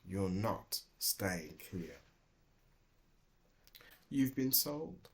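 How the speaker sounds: slow, soft delivery of hard news; gentle bad news. A narrator's reading. Owner tells Dilly she's sold, slow soft gentle. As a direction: break it to her gently.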